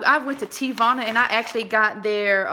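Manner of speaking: ordinary delivery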